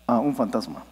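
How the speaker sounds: mock the calm reaction: "Ah, un fantasma"; deadpan, no scream. Shown as a demonstration. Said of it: "nadie aquí diría".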